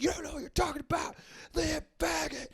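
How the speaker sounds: high, rushed imitation of an angry man; mock furious rant. Beer smasher ranting at the man filming, mock furious rant. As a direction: rattle off a held-back, high-pitched rage.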